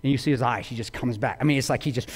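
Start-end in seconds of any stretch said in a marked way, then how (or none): none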